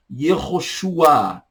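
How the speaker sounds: Yehoshua drawn out slowly, ye-ho-shu-a; slow Hebrew name. Shown as a demonstration. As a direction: stretch Yehoshua for the class. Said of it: "português".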